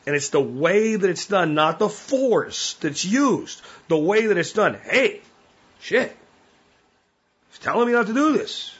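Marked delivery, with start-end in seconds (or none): 4.8-8.7 higher, surprised inner voice of the dog; startled dog's inner voice. Retold or imitated. Trainer voicing a dog's startled thoughts, higher pitch. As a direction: voice the dog catching on to the warning.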